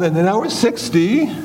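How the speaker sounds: normal talking voice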